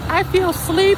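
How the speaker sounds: whiny squeaky impression; imitating his date. Tired date after a double shift, in a mock high falsetto. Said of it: "she's like".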